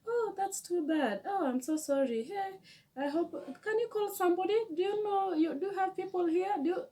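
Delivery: high, fast, concerned voice; a kind passerby fussing over her; lightly voiced worried stranger; sympathetic stranger.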